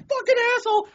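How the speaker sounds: very high, loud shout; mock angry yell. Drivers behind someone stopped at red, yelling. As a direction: imitate an irate driver yelling. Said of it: "everybody behind him's like".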